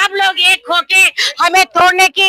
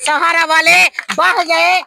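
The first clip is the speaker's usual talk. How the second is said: ordinary talk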